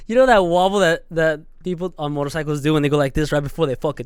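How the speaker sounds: ordinary talk, nothing marked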